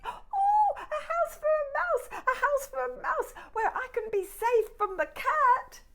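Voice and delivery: very high quick mouse voice; a tiny mouse pleading for safety. Acted.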